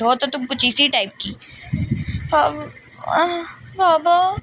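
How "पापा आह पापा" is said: frightened pleading. Acted. Daughter begs father not to hit.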